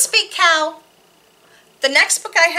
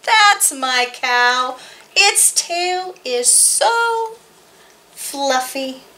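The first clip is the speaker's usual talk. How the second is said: put-on reading to children; slower than usual. Reads board book to children.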